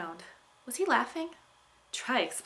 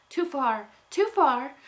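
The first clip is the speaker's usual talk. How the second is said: loud, high alarmed yell, louder the second time; 6 semitones higher, 8 dB louder, slower than usual. Acted. Unicorn to rider leaning too hard, alarmed yell. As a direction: cry out as she tips too far.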